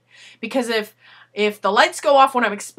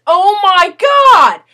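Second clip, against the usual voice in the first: pissed off; 8 semitones higher, 9 dB louder, much slower than usual. Retold or imitated. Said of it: "I was effing pissed".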